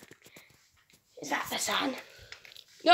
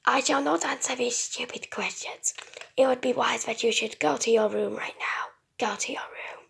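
cold whispered robot command; 5 dB quieter than usual. Acted. Robot orders its owner.

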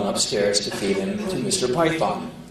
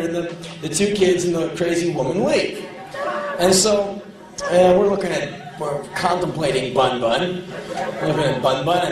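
normal talking voice

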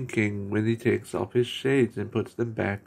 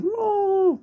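very high, loud playful roar; 4 dB louder than usual. Retold or imitated. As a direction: roar like a cartoon lion. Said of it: "Drooper going".

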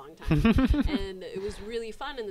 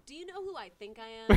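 mock diva; 8 semitones higher, 11 dB louder than usual. Mock diva line, topped.

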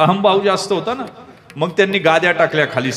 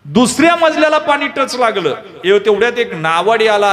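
ordinary talk

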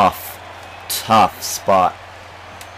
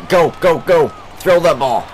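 urgent, higher, louder urging; excited yelling; 8 semitones higher, 4 dB louder than usual. Real, not acted. Player yelling at his team in a tense inning, excited shouting. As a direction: yell at the runner to run for it. Urging runner during close play.

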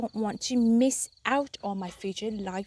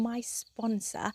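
whispered aside; 6 dB quieter, much slower than usual. Real, not acted. Whispered aside about the sponsor.

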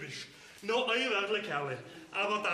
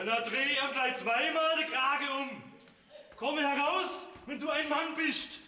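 challenging shout; 4 semitones higher than usual. Acted. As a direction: dare him to come out. Bauer fordert Rivalen heraus. (herausfordernd gerufen)

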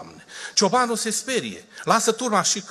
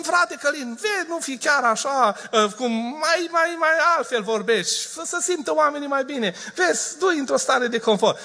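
raised, coaxing voice mimicking critics; critics' advice; 8 semitones higher than usual. Some people advising the preacher, in a mock-coaxing raised voice. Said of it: "Unii spun".

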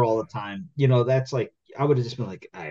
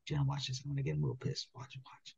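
retold whisper, much softer; 3 semitones higher, 18 dB quieter than usual. Daughter whispers to her sister before baiting Dad, hushed. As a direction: hush it like a kid plotting mischief. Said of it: "She goes".

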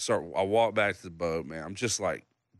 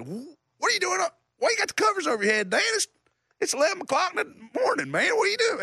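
much higher, loud teasing dad; imitating dad; 3 dB louder, faster than usual. Dad teasing his son under the covers, high loud mock voice. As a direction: needle him like a loud dad.

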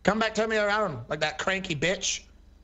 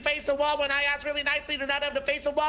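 higher-pitched, lightly voiced complaining old man; aggrieved old veteran; 7 semitones higher, faster than usual. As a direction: play a wronged old vet pleading his case. Plays old veteran Bill Dean.

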